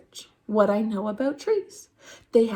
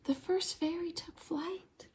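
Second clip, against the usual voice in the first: hushed storyteller whisper, much softer; 5 semitones higher, 13 dB quieter than usual. A narrator's reading. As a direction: breathe it like a secret. Hushed magical moment in story.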